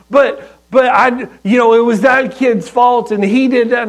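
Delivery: put-on high, loud, rushed little-boy excuses; kid blurting excuses. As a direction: babble out excuses like a caught child. Son blurting excuses when confronted.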